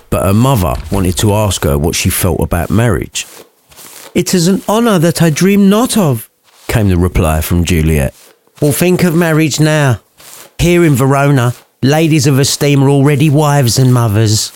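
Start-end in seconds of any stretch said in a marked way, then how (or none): 4.1-6.3 put-on high, prim young lady voice; posh Juliet. Narrator voicing Juliet, higher and posher. 8.6-14.5 lightly voiced common mum; a touch of a brassy mother; mum's voice. Lady Capulet to Juliet, lightly put-on mum voice. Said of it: "don't ask me how the mum ends up talking like that".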